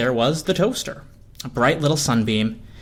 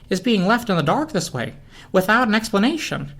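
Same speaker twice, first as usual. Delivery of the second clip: a higher, lightly voiced fretful lamp; tensor lamp agreeing; 8 semitones higher than usual. A narrator's reading. Narrator voicing the worried lamp, lightly raised. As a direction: worry aloud as a small fussy lamp. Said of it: "the tensor lamp agreed".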